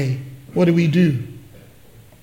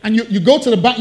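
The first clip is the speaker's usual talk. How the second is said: ordinary talk, nothing marked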